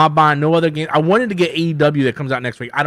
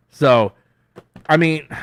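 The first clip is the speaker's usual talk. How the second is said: ordinary talk, nothing marked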